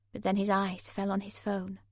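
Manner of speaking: plain reading voice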